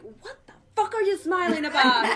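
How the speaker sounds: mock outrage, laughing through it; retold angry yell at husband. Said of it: "I was like".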